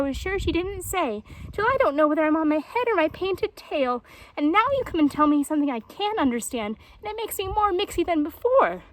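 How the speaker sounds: high, loud, flustered jaguar; Painted Jaguar. A narrator's reading. Muddled jaguar orders the tortoise into the river, loud. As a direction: rant in bewildered frustration.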